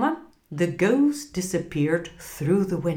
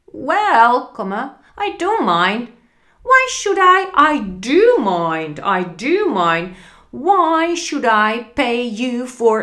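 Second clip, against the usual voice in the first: put-on grumpy old miser; 5 semitones higher, 7 dB louder than usual. Scrooge grumbling at clerk.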